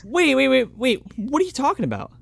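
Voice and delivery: high-pitched, rapid-fire bewilderment; baffled interruption. Real, not acted. Co-host missing the A-Team reference, high and baffled. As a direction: cut in, completely lost.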